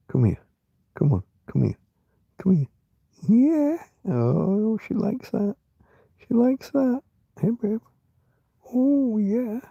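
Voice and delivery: very high, delighted pet-talk coo; cooing to the cat. Real, not acted. Petting her cat, very high delighted coo. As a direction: gush over the cat in a squeaky coo.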